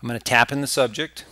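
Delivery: normal talking voice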